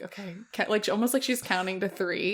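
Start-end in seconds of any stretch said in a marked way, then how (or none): 0.0-0.3 mock-composed, much softer; dramatic breath. Podcaster imitates the film heroine composing herself, hushed and dramatic. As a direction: take a little dramatic breath, barely voiced. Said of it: "she takes these little dramatic breaths".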